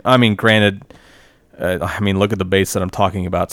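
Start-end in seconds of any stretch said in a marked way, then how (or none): none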